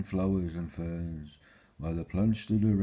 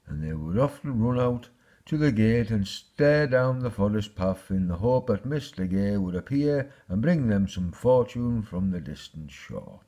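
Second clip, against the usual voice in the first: plain reading voice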